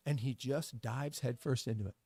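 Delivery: plain reading voice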